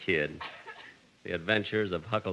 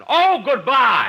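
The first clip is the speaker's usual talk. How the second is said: startled goodbye; 14 semitones higher, 11 dB louder, slower than usual. Acted. Gracie hangs up on him.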